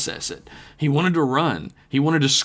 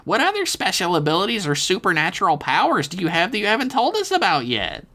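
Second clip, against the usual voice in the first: high, squeezed kid voice, still voiced; high curious stage whisper; mock whispering Dev; 9 semitones higher than usual. A narrator's reading. Dev asking the ghost about his powers, high and secretive. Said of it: "Dev continued to whisper".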